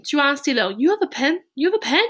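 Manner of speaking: "You have a pen?" lifted high as a question; questioning inflection. Shown as a demonstration. Teacher showing inflection to French students, high questioning tune. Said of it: "this is just inflection".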